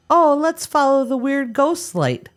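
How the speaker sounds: high-pitched horror-movie victim; mock naive enthusiasm. Co-host mocking how horror movies start, mock naive voice. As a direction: put on a high, gullible voice.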